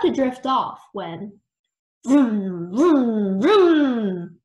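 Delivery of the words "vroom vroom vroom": loud, growing engine revs; engine vroom. A narrator's reading. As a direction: rev it up louder each time. Engine noise interrupts sleep.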